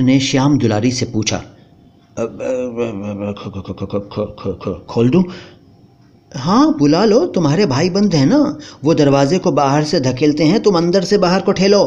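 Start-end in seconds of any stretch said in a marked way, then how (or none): 1.4-5.2 slow, soft, stuck stammer; faltering stammer. Acted. Husband asks whether to open. 6.3-11.8 higher, mocking wife's voice; wife sarcastically mocking her husband; scornful wife.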